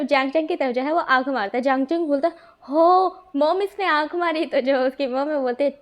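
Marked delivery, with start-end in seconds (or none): 2.6-4.4 tattling to mom. Acted. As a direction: drag out the "हो मॉम" like a tattling kid. (Mom को चिल्लाकर शिकायत करता बच्चा)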